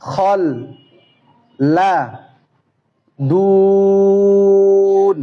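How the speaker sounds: syllable by syllable, long pauses between each; broken syllable recitation. Shown as a demonstration. Teacher showing children syllable-by-syllable Quran reading. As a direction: chant each syllable of the verse apart, slowly. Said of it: "একটি line পড়ে দেখাচ্ছি".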